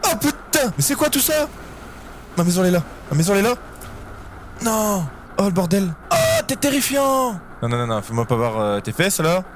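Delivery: frantic shouting near the base; panicked yelling. Real, not acted. Surrounded by monsters at base.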